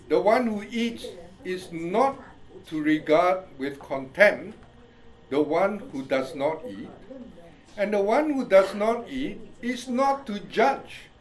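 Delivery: usual speaking voice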